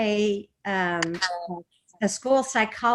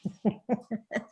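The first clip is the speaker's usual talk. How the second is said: short laugh; 6 dB quieter than usual. Real, not acted. Laughs after the mantra.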